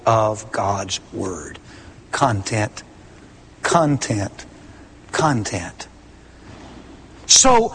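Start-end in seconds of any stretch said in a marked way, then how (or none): 2.1-5.9 soft, low "content" said three times; quiet repeated content. Shown as a demonstration. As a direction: echo "content" softly, lower and lower. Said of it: "He said it with emphasis".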